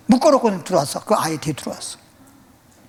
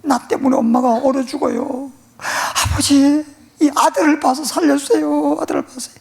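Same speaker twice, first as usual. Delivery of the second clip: begging son; 8 semitones higher than usual. Retold or imitated. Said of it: "아이가".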